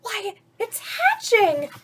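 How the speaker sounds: high, loud, thrilled exclamation; excited shout. Acted. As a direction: burst out with delight at the hatching. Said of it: "shouted Horton".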